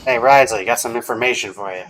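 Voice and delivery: ordinary delivery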